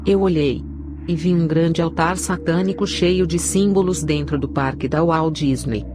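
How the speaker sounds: ordinary delivery, nothing marked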